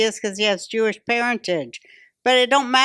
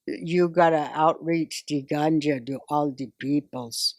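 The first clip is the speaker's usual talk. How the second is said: fake Jamaican accent; 7 semitones lower, 4 dB quieter than usual. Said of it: "Now she's putting on the fake Jamaican accent".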